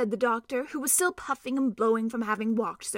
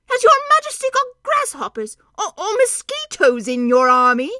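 much higher, loud laughing question; amused; 10 semitones higher, 11 dB louder than usual. Acted. Doctor laughing at a tiny unseen soldier, loud amused.

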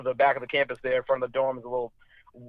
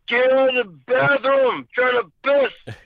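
put-on higher, slow drunken voice; drunk roommate's mumble; 8 semitones higher, slower than usual. Drunk roommate shooing him from his imagined bathroom, slow and high. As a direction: drag it out like a sleepy drunk, pitched up. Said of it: "And I was like".

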